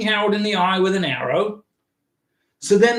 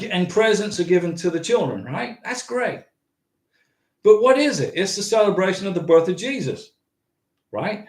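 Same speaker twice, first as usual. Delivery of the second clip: normal talking voice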